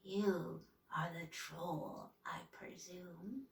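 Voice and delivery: low, slow, raspy hag voice. A narrator's reading. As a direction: rasp it out slowly, softly. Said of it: "said in a raspy voice".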